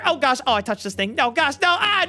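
normal talking voice

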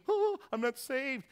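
mock alarm. Retelling panic to dean.

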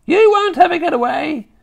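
high, loud witch shout. A narrator's reading. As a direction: shout after him like a hag. Witch shouts after fleeing boy.

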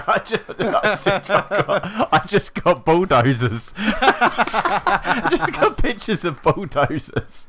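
helpless laughter through the words; laughing. Real, not acted. Player cracking up over a silly image search, laughing.

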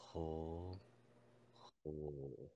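deep drawn-out hoo; low voice. Real, not acted. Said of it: "すごい、すごい低い声で。". (低い声で)

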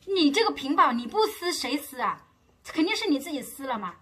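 accusing glare. Retold or imitated. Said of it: "瞪着眼睛跟我说".

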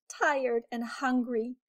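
ordinary delivery, nothing marked

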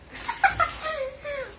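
squealing giggle; high-pitched burst of laughter. Real, not acted. Laughing at partner.

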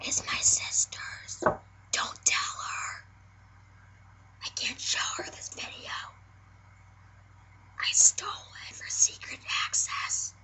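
hushed secret whisper. Acted. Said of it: "be our little secret".

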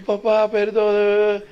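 high, very slow mock-crying child; mock sobbing plea. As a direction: wail an apology like a caught kid. Child begging forgiveness, crying. (niño llorando, suplicando perdón en broma)

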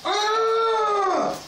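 high shriek of panic; panicked high cry. Acted. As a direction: shriek in alarm.

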